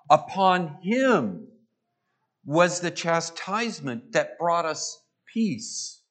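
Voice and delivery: grave proclamation of the prophecy; solemn scripture reading. Acted. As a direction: lay each line down heavily. Reads Isaiah fifty-three aloud.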